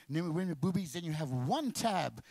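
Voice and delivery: ordinary delivery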